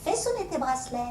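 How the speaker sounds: lightly voiced grandfather; grandfather answering. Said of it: "grand-père répondait".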